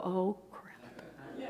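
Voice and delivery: soft whispered groan of dismay; muttered dismay. Retold or imitated. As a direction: whisper it under your breath.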